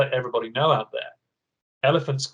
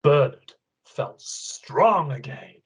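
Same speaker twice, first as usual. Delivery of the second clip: ordinary talk, nothing marked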